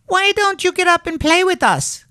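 very high, loud squeaky-voiced rabbit; a playful high-pitched rabbit calling to a new friend; wild rabbit. A narrator's reading. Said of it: "one of them asked".

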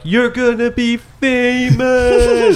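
high, drawn-out singsong call; calling after someone. Acted. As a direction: stretch it out as a hammy send-off. Calling after a departing character.

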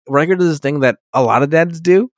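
normal talking voice

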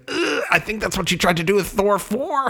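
high, laughing mock groan at the director. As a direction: roll your eyes and laugh through it, pitch up. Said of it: "which is like".

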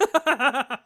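loud, high burst of laughter. Real, not acted. Laughs at co-host's remark.

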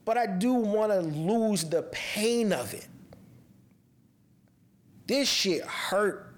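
slow, heavy words of a grieving mother; real grief. Real, not acted. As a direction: let each word weigh with loss, slowly. Grieving a dead daughter.

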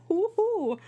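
a playful ogling, hot gargoyle; excited hooting. Retold or imitated. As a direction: hoot it out like spotting a hunk. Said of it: "And I was like".

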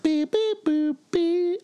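high electronic beeps; beeping noises. Acted. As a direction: mimic a beeping machine. Imitated sound after trailer.

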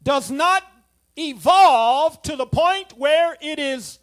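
loud, slow, emphatic sermon delivery; full-force preaching. Acted. Preacher warning the church not to drift, loud and slow. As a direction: drive the warning home to the pews.

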